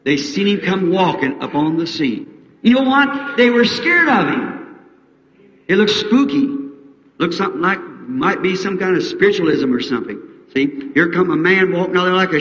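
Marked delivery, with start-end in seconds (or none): 2.6-6.5 high, rising preacher excitement; high dramatic build; excited preaching. Real, not acted.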